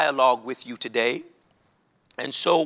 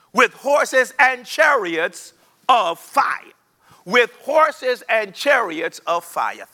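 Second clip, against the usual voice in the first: building preaching climax; 5 semitones higher, slower than usual. Acted. Preacher exults over the chariots of fire, slow and soaring. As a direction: let the vision swell with joy.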